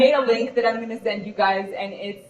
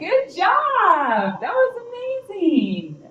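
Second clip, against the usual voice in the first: high, bright, drawn-out praise; excited praise; 11 semitones higher, much slower than usual. Real, not acted. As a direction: gush over her, going high and slow. Cheering a classmate's meditation.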